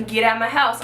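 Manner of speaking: ordinary delivery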